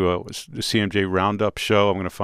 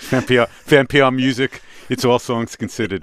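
louder put-on New York accent; 3 semitones higher, 4 dB louder than usual. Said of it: "How do you turn that accent off?".

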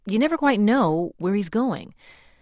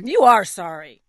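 louder, sharp reproach; reproachful Piggy; 2 semitones higher, 9 dB louder than usual. Acted. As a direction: throw it back at him. Piggy angry at elephant.

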